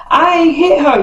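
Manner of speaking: high whiny denial from the cousin; mock denial. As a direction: play innocent in a high voice. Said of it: "Cash is just like".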